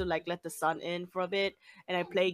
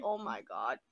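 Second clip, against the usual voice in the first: muttered despair; 10 semitones lower, 9 dB quieter than usual. Retold or imitated. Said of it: "I was like".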